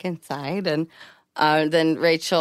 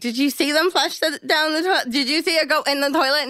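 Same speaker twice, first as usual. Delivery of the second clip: skeptical high questioning; 8 semitones higher, faster than usual. Retold or imitated. Retold doubtful questioning.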